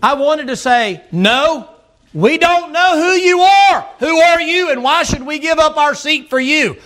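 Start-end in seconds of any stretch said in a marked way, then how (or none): 1.1-6.8 indignant refusal. Retold or imitated. Retold refusal to seat someone.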